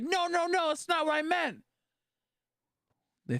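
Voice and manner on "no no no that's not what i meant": much higher, frantic mock protest; flustered, backpedalling fast; a hammed-up squeaky no no no; mock panicked denial.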